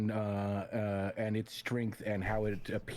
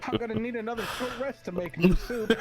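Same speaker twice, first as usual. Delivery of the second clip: talking through laughter, high and loud; laughing; 11 semitones higher, 8 dB louder than usual. Real, not acted. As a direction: giggle out each word.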